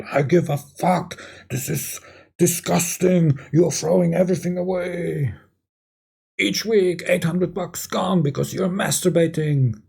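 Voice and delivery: high-pitched mock wife rant; angry outburst. Wife ignores husband's plea to be silent, angry high-pitched outburst. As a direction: pitch it up into an acted, disgusted tirade. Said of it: "complaining and bursting out in anger".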